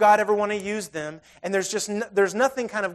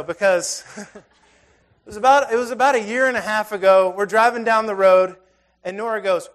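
ordinary talk

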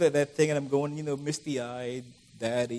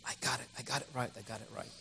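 soft, fast inner thrill; 4 semitones lower, 13 dB quieter, faster than usual. Retold or imitated. Photographer's inner voice on the perfect shot, soft and fast. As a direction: murmur it to yourself in quick delight. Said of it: "goes inside you, goes".

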